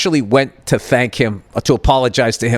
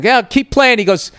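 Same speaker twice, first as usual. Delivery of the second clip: higher, needling taunt; mocking other dad; 8 semitones higher than usual. Mocking the other dad who teased him at the play gym, higher and needling. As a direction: mimic a smug dad ribbing him. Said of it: "that classic fucking, you know, this is Larry Liberal".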